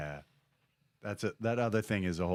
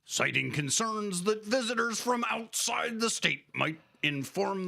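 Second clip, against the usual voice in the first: news read; 5 semitones higher than usual. Acted. Reads news segment.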